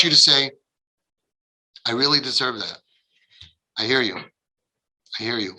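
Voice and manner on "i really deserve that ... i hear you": low, soft, patient acknowledgement; accepting, contrite. Acted. Parent hearing out an angry daughter, soft and accepting. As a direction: take the blame gently, letting it settle.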